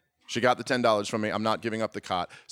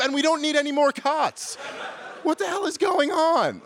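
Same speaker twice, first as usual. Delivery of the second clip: very high-pitched comic outrage, building higher; furious rant; 13 semitones higher than usual. Retold or imitated. Guest berates hotel manager over the group question, furious. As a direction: rant indignantly at the top of your range. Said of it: "I was just getting furious".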